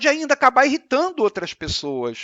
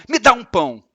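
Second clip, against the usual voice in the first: loud bossy demand; 2 semitones higher, 8 dB louder than usual. Shown as a demonstration. Teacher showing a rude reading of a text. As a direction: snap it out like an order. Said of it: "Outros podem interpretar aquilo como".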